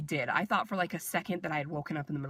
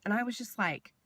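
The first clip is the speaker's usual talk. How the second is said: usual speaking voice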